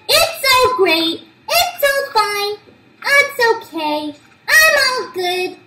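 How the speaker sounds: crowing over the first page; triumphant gloating; excited. Real, not acted.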